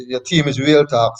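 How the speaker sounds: ordinary delivery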